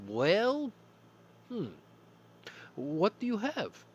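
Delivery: lightly voiced high customer, slow. Host voices the customer asking the Soviet clerk what's on the menu. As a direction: play the dithering customer.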